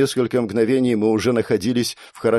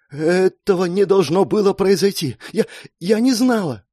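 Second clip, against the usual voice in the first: much higher, upset protest; distraught; 9 semitones higher than usual. Acted. Girl tells dying Mark she didn't know, distraught. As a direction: stammer in horror, voice pitched high. Rescuer horrified, apologising to dying friend.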